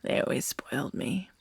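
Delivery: soft, unvoiced sinister whisper; creepy whisper. Captor whispering to the bound listener, creepy whisper. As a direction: whisper like a captor savoring control.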